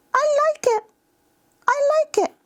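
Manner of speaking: put-on very high, squeaky child voice; high-pitched kid enjoying a toy; little child. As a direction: pipe up like a small kid. Child responding to toy in role-play.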